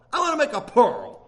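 ordinary talk

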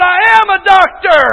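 high, loud pompous declaration; arrogant doctor. Retold or imitated. Preacher repeating the arrogant doctor's answer, pompous and loud. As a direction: puff yourself up and proclaim it. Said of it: "He raised up and come down".

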